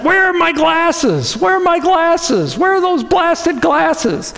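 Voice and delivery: hammed-up grumble, hunting all over the house; getting upset. Pastor acting himself hunting lost glasses, mock frustrated. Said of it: "starting to get upset".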